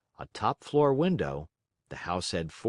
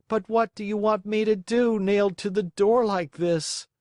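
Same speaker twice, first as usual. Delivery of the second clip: raised pleading puppet voice; pleading boy; 11 semitones higher, 4 dB louder than usual. Acted. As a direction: beg through the cold. Pinocchio complains.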